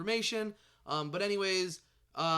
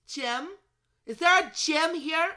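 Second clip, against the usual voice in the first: goofy put-on voice, climbing higher and louder; silly questioning call; 10 semitones higher, 8 dB louder, slower than usual. Host mocking how he said Jim, silly escalating call. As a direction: build from a questioning "Jim" to a loud, high call. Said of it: "I don't know why I said it like that".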